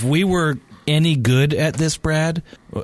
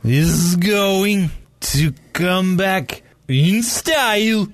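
slow, higher warped garble; backwards speech; 5 semitones higher, much slower than usual. Pretend. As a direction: mimic audio played in reverse. Imitating reversed speech.

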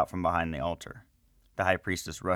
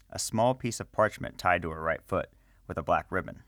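ordinary delivery, nothing marked

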